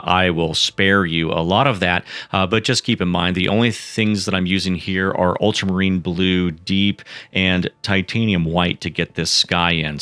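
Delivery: ordinary delivery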